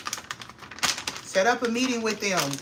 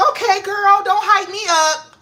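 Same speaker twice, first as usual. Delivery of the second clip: high, loud, flattered protest; 11 semitones higher, 8 dB louder than usual. Retold or imitated. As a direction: wave off the praise, thrilled. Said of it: "So I'm like".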